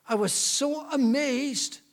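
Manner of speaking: a touch of wonder, slowed right down. Retold or imitated. Lady tells the church woman of her amazement, high and slow.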